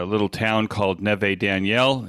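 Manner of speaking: ordinary talk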